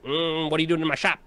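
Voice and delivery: high, fast snooty shop clerk; snooty shopkeeper. Retold or imitated. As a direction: sneer at the customer. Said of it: "I was like".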